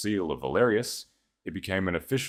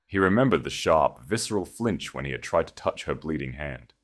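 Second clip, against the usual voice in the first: plain reading voice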